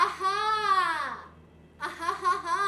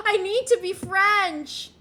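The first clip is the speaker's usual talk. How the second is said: 1 mock whine; 2 semitones higher, 8 dB louder, slower than usual. Mock longing to be French.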